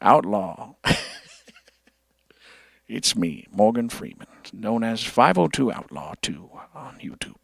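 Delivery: low, quiet narrator voice; Morgan Freeman impression. Streamer voicing Morgan Freeman as his friend Outlaw, low and hushed. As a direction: play Morgan Freeman with a YouTube channel. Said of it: "I'll take Morgan".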